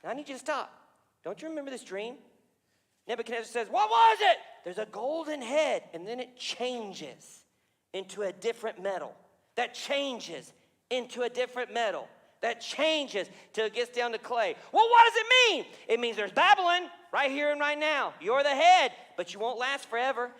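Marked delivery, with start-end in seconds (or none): 3.7-4.4 much higher, loud question; the king loudly asking about his dream; eager king. Acted. Nebuchadnezzar asks Daniel. 14.7-15.7 much higher, loud question; the king loudly asking about his dream; eager king. Acted. King demanding meaning.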